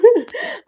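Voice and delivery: a sudden happy laugh; high laugh. Real, not acted. Laughing with viewers at the hearts.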